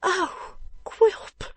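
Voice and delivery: high, frightened wife's voice; startled little yelp; startled cry. A narrator's reading. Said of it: "cried his poor little wife".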